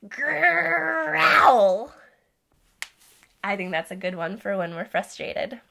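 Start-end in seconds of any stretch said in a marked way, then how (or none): 0.1-1.9 loud growly lion roar; play-growl for the kids; demo growl. Shown as a demonstration. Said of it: "Can you growl with me?".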